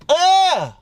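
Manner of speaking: sudden high-pitched "ugh"; imitated rejection noise. Guy imagining getting shot down, high mock "ugh". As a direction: cut the daydream off with a high mock grunt.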